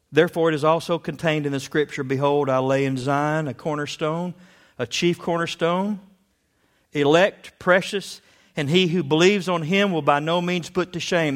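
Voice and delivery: loud, fervent scripture reading; preaching scripture. Acted. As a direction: proclaim it from the pulpit. Reads scripture with rising force.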